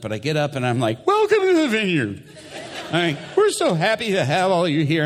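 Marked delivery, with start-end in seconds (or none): 1.1-5.0 very high, loud mock-panicked welcome; nervous, not calm. Pastor greeting church with a gunman present, mock nervous panic. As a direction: squeal a hammed-up nervous greeting. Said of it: "I wish I could say I was totally calm".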